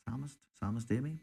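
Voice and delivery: ordinary talk, nothing marked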